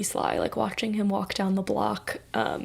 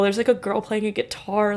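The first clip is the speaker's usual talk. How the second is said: ordinary delivery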